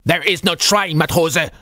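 high, gruff scolding; stern rebuke to a junior sailor; veteran scolding. A narrator's reading. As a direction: scold him like a veteran navy chief. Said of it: "the veteran scolded his junior sailor".

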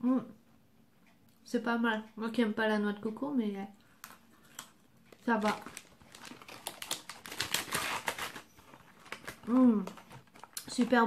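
chewing while talking; talking between bites; mouth full. Real, not acted. Seen 1: Eats snack stick at 0.3-2.9, chews while talking after.

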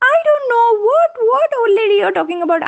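very high put-on protest; old lady feigning ignorance. A narrator's reading. Old lady hidden in pumpkin fooling the bear, high protest. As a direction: pitch it way up, denying everything. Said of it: "the old lady sitting inside, she said".

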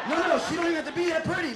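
fast, loud insisting; excited insistence. Real, not acted. Comic correcting himself to the crowd, fast and loud. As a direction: rush it, pushing the point.

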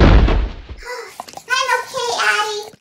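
put-on high, loud boy grumble; grumbled. A narrator's reading. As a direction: grumble as a kid after a tumble. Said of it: "grumbled Emmett".

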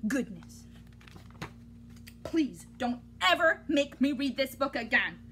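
usual speaking voice

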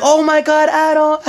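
high squealing "Oh my God, Adam"; high excited fan shriek on spotting him. Retold or imitated. Fans spot him in Sephora.

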